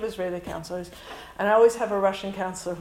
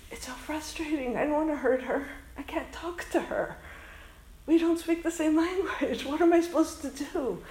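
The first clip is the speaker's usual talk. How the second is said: higher, understated mock-tearful plea; tearful imitation; 6 semitones higher than usual. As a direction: retell her helpless, crying frustration. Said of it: "she starts to cry too".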